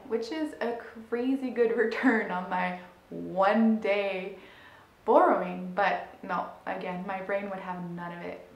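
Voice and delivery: ordinary delivery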